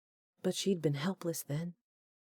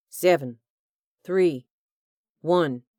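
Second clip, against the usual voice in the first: loud, taunting countdown; 7 dB louder, slower than usual. A narrator's reading. Hugh cheating the countdown over the radio, loud taunt. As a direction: skip numbers to mock them.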